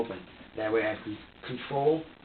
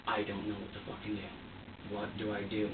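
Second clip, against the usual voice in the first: defeated despair; 3 semitones lower, 9 dB quieter than usual. Retold or imitated. Imagined despair with own kids.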